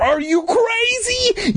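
very high, drawn-out outraged question; enraged cry. A narrator's reading. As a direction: stretch it out in a frenzy. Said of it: "his face red with anger".

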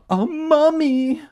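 very high, loud, slow shriek. A narrator's reading. Boy spots the mummy behind his uncle, shriek. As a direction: shriek it long in theatrical terror. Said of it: "I shrieked".

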